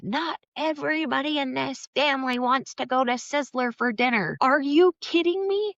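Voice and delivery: shrieking it like the furious sister did; retold screaming. Sister screaming down the phone at her, a mock shrill yell. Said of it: "She's, like, screaming at me".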